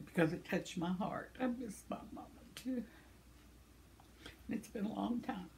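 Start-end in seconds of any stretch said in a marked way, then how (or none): 1.4-2.8 hushed, unvoiced words near tears; tearful whisper. Real, not acted. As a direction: whisper it as the grief rises. Missing her late mother.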